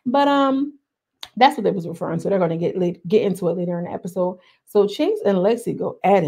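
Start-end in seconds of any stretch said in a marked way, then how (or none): none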